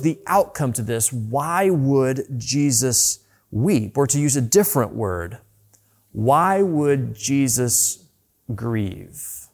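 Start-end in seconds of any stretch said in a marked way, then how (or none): 1.1-4.0 slow rhetorical. Acted. Rhetorical question slowed. 6.1-9.5 slow rhetorical. Acted. Rhetorical question slowed.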